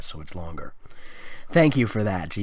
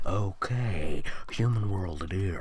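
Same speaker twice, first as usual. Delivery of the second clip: softer, lower and whispered agreement; hushed Sunset voice; 6 semitones lower, 6 dB quieter, slower than usual. Acted. Sunset agreeing with Twilight in a low hushed whisper. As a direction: breathe it out low and soft.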